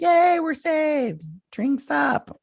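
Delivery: high, slow, mocking cheer of the rescued crew. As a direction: send up their cheer, way up high and drawn out.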